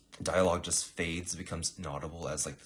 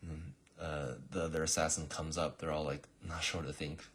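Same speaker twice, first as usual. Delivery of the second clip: ordinary delivery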